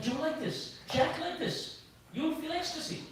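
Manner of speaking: feed the cue discreetly; Gadadhar Pandit prompting. Acted. At Chaitanya's side teaching him devotion, soft prompting. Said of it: "Gadadhar Pandit is always there next, prompting".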